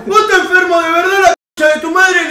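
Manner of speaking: loud, high angry outburst reliving the fight; retold furious insult. Retold angry confrontation with friend. (al amigo que confiesa, grito furioso y agudo)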